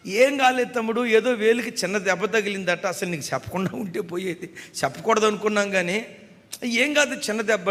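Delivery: called out high and loud, soothing. Retold or imitated. Relatives calling loudly to reassure the crying brother. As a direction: insist loudly it is nothing.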